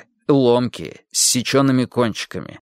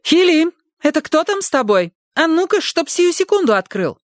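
much higher, scolding woman through a door; a bossy housekeeper demanding entry; angry maid shouting. A narrator's reading. Maid shouts through the door at the boy, much higher.

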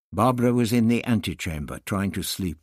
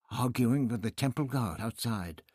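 plain reading voice

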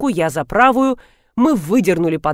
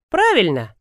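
usual speaking voice

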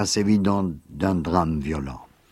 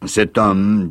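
grave; 7 dB louder than usual. Acted. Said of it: "dit Gilda gravement".

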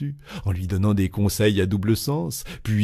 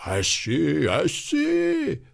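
high, drawn-out wail climbing higher; vibrant cry; 8 semitones higher, much slower than usual. A narrator's reading. Loiseau mimicking the goings-on upstairs, crying out vibrantly. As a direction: cry it out with mock urgency. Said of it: "il poussait d'une voix vibrante".

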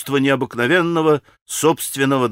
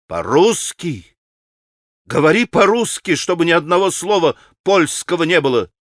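higher-pitched, exasperated demand; impatient shouting; 3 semitones higher than usual. A narrator's reading. Grushenka orders the pan to speak Russian, shouting. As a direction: snap out the order, all patience lost. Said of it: "закричала она на него".

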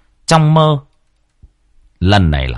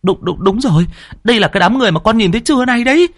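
higher, fast, eager blurt; a boy confirming what he saw; hào hứng; excited boy; 14 semitones higher, faster than usual. A narrator's reading. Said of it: "nó lên mồm".

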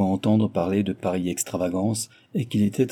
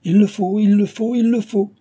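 loud, much higher insistent cry; 12 semitones higher, 7 dB louder than usual. Acted. Guardian crying out to Cécile that she must return them, loud and high. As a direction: hammer it home, shouting each time. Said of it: "s'écria-t-il".

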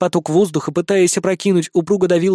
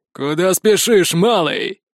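slow, menacing guard call; threatening guard; slower than usual. A narrator's reading. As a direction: block his way with a threat. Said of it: "угрожающе крикнул хмурый страж". (угрожающий окрик стражника)

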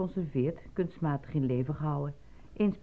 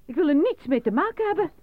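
angry refusal; 14 semitones higher, 10 dB louder than usual. Acted. Worker angrily refuses any part in the killing, loud. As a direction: refuse him outright, furious.

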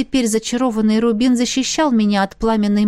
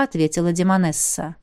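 plain reading voice

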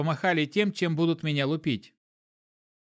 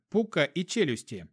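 ordinary delivery

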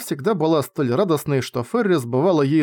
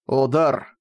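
usual speaking voice

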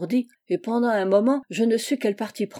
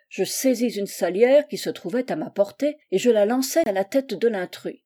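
ordinary delivery, nothing marked

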